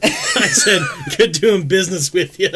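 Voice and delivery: loud, high, through laughter; laughing. Real, not acted. Retelling the nudes deal punchline, laughing loudly. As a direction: crack up while saying it.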